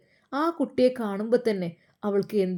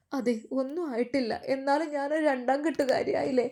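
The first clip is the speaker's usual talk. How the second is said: higher and softer, a mild tearful break; 5 semitones higher, 4 dB quieter than usual. Acted. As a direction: speak as if about to cry. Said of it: "അവൾ വിങ്ങിപ്പൊട്ടുകയാണ്". (വിങ്ങിപ്പൊട്ടുന്ന പോലെ ഉയർന്ന, പതിഞ്ഞ ശബ്ദം)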